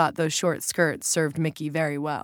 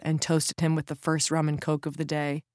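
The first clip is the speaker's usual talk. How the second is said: usual speaking voice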